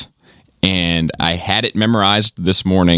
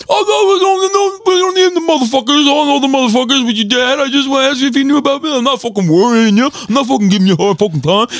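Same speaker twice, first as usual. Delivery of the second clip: very high, louder put-on yell; Joel shouting; 3 dB louder than usual. Joel yelling into the dad's voicemail, imitated shout. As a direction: holler it from the background like a rattled old buddy. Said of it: "you hear Joe in the background like".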